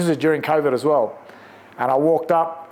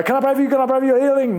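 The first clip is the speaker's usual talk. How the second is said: much higher, sing-song imitation of an over-eager healer; mocking over-eager street evangelists, much higher sing-song; mocking pushy preacher; 9 semitones higher, much faster than usual. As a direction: send up the guys who push healing prayers. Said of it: "I'm not one of those guys that walk up and go".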